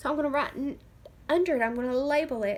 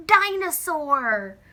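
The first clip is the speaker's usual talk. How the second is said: slow, loud dinosaur; excited reveal; 4 semitones higher, 12 dB louder, much slower than usual. Shown as a demonstration. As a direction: stretch out the big reveal. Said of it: "D, d, d".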